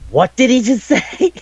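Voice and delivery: high squeak broken by a laugh; freaked-out disbelief. Retold or imitated. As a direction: gasp at it, half laughing. Sukune quoted reacting to ogre.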